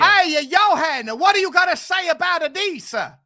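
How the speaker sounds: put-on loud comic Italian accent. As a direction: challenge him in hammed-up Italian English. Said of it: "doing an Italian accent again".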